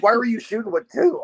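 onlookers' astonished question. Retold or imitated. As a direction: throw it up high like they can't believe it. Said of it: "they're like".

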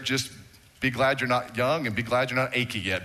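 normal talking voice

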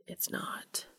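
barely voiced whisper; breathed. A narrator's reading. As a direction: breathe it simply. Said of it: "Cass breathed simply".

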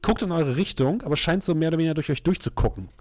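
usual speaking voice

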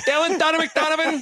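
high, loud mom calling out; mom calling kids. Pretend. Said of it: "the Raiola mom calling him for dinner".

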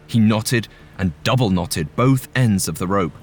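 ordinary delivery

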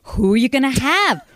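high, loud, excited guessing; excited pestering. Retold or imitated. Mother badgering daughter about the guest, excited pestering. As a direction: pester loudly with eager questions. Acting out her eager phone calls.

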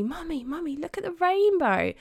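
put-on light, high child's voice, lightly done; a hint of an excited small daughter; Chloe's voice. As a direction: mimic a little girl calling excitedly. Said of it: "Chloe was saying to me".

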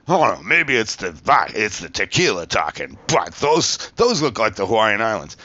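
loud, mocking imitation of an obnoxious judge; Tony Hundahl. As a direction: mimic a tipsy judge, loud and swooping. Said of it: "that fucking asshole too, Tony Hundahl, kept on being like".

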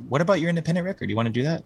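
lightly voiced eager label founder; quoting a friend. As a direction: pitch it up and fast like an eager friend. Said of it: "he's like".

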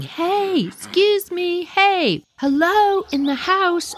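high, insistent hail without full volume; mock yelling. Narrator trying to rouse the homeowner, high mock yell. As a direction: call out to someone indoors, pitched high.

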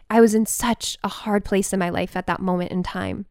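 usual speaking voice

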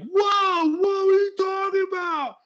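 squeaky high mocking whoa; high mock surprise. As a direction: mimic him shrill and shocked. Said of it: "And you were like".

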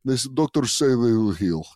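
lightly voiced mock Russian fighter; broken English as a Russian MMA fighter; a hint of a Russian accent. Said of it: "doing a Russian accent".

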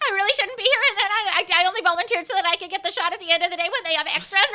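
1 nervous volunteer. Retold or imitated. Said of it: "being like".

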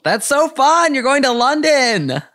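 high, gushing excitement; excited. Real, not acted. Cheering on a guest's London dates, high and excited. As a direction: gush with delight, pitch way up.

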